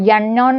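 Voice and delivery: "യണ്ണോൺ" said with YA at the start instead of a plain A; wrong first vowel. Shown as a demonstration. Teacher shows learners a misreading of unknown, "യണ്ണോൺ" with initial YA. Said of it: "യണ്ണോൺ എന്നല്ല".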